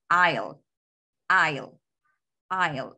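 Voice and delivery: "I'll" as one falling syllable; short form. Shown as a demonstration. Teacher shows the short form, "I'll" in one falling syllable. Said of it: "Aquí está la pronunciación".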